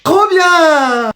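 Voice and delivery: high, drawn-out cheer; excited cheer. Acted. Children hear it's McDo, cheering. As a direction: whoop it with childish glee.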